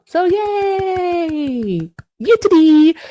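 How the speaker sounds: high happy squeal of yay; excited cheer. Real, not acted. Mom cheering to chat that her son is home. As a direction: burst out cheering.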